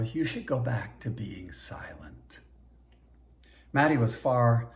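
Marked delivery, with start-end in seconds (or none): 0.0-2.4 a cold whispered threat; menacing whisper. Acted. Governor whispers down to the slave girl, menacing whisper. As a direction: hiss it softly and slowly, unvoiced.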